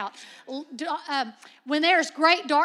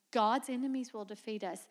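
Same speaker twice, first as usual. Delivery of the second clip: God's enemies said softer, dropped down; quieter retake; 4 semitones lower, 7 dB quieter than usual. Shown as a demonstration. Preacher restarts the spies' line more quietly. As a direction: restart the quote softly after stopping herself. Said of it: "Wait, hold on".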